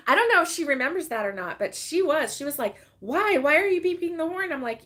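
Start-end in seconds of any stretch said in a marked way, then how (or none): 3.0-4.5 high, incredulous questioning; playfully retold disbelief; mock baffled protest. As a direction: imitate someone demanding an explanation. Retells daughter-in-law's baffled objection.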